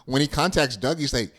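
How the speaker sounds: ordinary delivery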